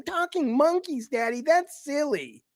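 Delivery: put-on high, slow sing-song child voice; mocking little girl. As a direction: parody a cutesy little girl. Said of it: "Who the fuck wanted a T-rated God of War game?".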